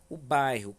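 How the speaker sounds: "bairro" dito mais alto e marcado; ênfase em bairro. Shown as a demonstration. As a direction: destaque a palavra "bairro". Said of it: "qual bairro no texto que foi citado?". (acentuando "bairro" em voz mais alta)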